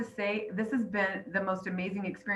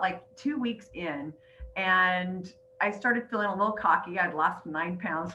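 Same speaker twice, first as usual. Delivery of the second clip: ordinary talk, nothing marked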